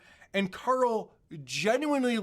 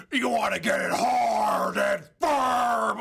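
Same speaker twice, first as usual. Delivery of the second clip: slow, hammed-up sexy ad voice; mocking over-the-top ad read; 2 semitones higher, 4 dB louder, slower than usual. Co-host mocking Opie's ad read with a hammed-up slow voice. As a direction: drag it out like a cheesy ad. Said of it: "it's a little over the top".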